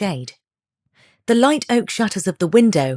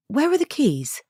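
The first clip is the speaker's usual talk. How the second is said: a bit anxious; 8 semitones higher than usual. Acted. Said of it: "sounding a bit anxious".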